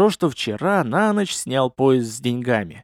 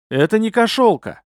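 higher, offended retort; 7 semitones higher than usual. Acted. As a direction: sound a bit hurt. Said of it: "я даже обиделся".

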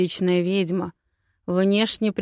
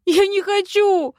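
very high, loud wail "Я не хочу"; louder whine; 13 semitones higher, 9 dB louder than usual. Acted. Heroine wailing her refusal, even higher and louder. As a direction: escalate into a full childish wail. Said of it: "захныкала".

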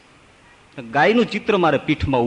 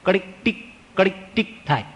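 mimicked clicking of betel nut in the teeth, higher; imitating a crunch; 4 semitones higher, 4 dB quieter, faster than usual. Shown as a demonstration. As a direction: click out ટીક and કડીક like nut cracking. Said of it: "દાંતમાં આમથી આમ આવે એટલે ટીક". (સોપારીના કટકાનો અવાજ)

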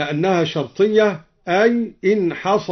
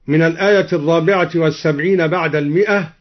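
ordinary delivery

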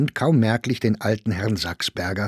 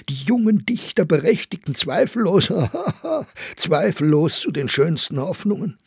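high, mocking read-out broken by laughter; ironic emphasis; 8 semitones higher than usual. A narrator's reading. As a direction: read it out derisively, laughing at the words. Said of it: "las mit ironischer Betonung". (mit ironischer Betonung, höhnisch lachend)

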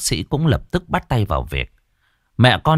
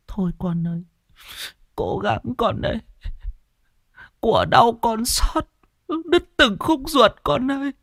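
put-on grieving old mother; 13 semitones higher than usual. Mother lamenting with her son, high and tearful. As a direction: wail gently like a heartbroken grandmother.

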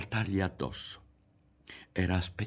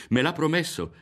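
higher, louder, glad boast; delighted, pitched up; happy; 9 semitones higher, 6 dB louder than usual. A narrator's reading. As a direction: say it with happy pride. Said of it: "riprese Berto felice".

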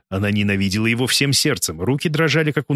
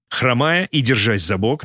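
usual speaking voice

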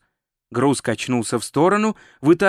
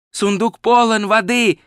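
ordinary delivery

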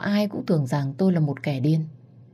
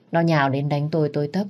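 plain reading voice

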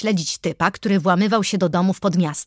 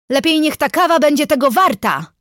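high, loud call through a door; raised voice, grumbling shout; 7 semitones higher, 5 dB louder than usual. A narrator's reading. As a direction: call to someone in the next room. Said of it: "zawołałam przez drzwi".

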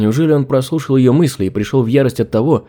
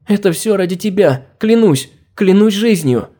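panicked pleading; 6 semitones higher than usual. Acted. Panicked servant begs her master.

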